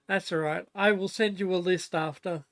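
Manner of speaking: loud, friendly offer across the house; calling out. Real, not acted. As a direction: call it over to a housemate.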